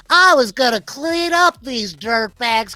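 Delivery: put-on old gambler shouting at Lux from below; annoyed shout. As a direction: yell it up at her, fed up. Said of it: "this voice is like".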